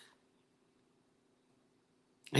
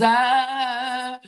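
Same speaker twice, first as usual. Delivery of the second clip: long, high, louder groan; exasperated groan; 9 semitones higher, 4 dB louder than usual. Real, not acted. Fed up with stifling our emotions, exasperated groan. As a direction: let out a drawn-out frustrated noise.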